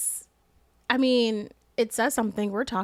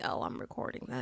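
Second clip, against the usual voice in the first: whispered aside; 14 dB quieter than usual. Retold or imitated. Retelling recording a salon fight.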